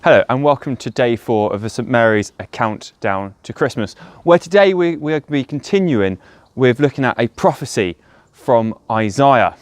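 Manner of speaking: ordinary talk, nothing marked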